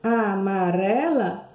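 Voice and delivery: "Amarela" stretched very slowly with a tapped tongue R; slow tapped R demo. Shown as a demonstration. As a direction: draw out "Amarela" so the R trill is heard. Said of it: "Treme a língua". ("Amarela" bem devagar, tremendo a língua no R)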